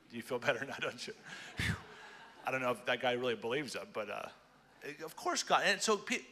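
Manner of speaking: ordinary talk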